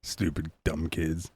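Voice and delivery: low, slow, very soft grumble; muttered mock disdain. Killer shaking his head at victims, muttered disdain. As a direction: mutter it wearily under your breath.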